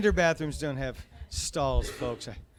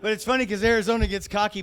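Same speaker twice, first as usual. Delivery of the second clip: usual speaking voice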